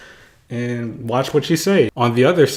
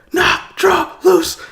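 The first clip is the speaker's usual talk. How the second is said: much higher, louder called commands; archery command; 5 dB louder, much slower than usual. Retold or imitated. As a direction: bark it like an archery coach. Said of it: "so she is like".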